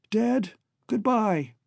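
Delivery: high, slow, choked goodbye; choked farewell. Acted. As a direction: bid your father a halting farewell. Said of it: "his voice choked".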